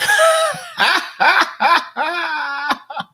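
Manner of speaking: long, high-pitched cackling laugh; helpless high laugh at own joke. Real, not acted. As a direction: burst out in sustained high laughter.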